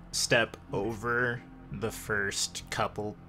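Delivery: ordinary talk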